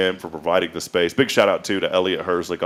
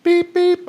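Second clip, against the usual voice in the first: high beeping like a truck reversing; backup beeps; 6 dB louder than usual. Pretend. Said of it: "I just wanted to do a couple of beeps".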